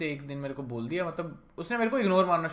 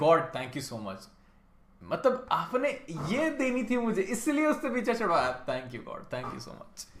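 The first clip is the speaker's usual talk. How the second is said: grateful relief; 4 semitones higher, slower than usual. Retold or imitated. Thanking God for new girl.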